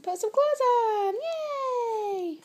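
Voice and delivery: high happy cheer. Real, not acted. Cheering baby.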